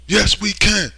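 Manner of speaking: high, loud mocking "Yes, we can"; mock campaign cheer; mock slogan. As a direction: sneer the slogan like a rally cheer.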